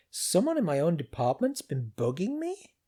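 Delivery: ordinary delivery, nothing marked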